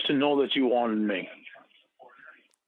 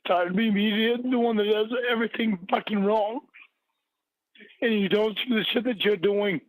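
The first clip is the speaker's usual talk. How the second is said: near tears; 7 semitones higher than usual. Real, not acted. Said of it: "What are you crying?".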